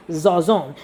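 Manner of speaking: ordinary delivery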